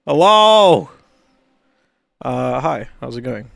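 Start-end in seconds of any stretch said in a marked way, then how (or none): 0.1-0.9 much higher, louder called-out hello. Acted. Greeting the viewers with a loud called-out hello. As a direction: call hello out to the room. Greeting chat as stream opens.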